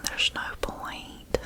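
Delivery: ordinary talk, nothing marked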